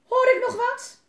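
high, loud furious shout; enraged dad demanding a reply. Acted. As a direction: yell for an answer. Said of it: "Hij was woedend".

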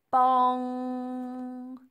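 long, loud ringing bong like a huge bell; be Big Ben striking. A narrator's reading. Big Ben chimes above Elsie, a loud held bong.

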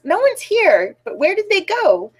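high, puzzled cry at normal volume. A narrator's reading. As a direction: exclaim it with pitch swooping up. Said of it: "Bear cries".

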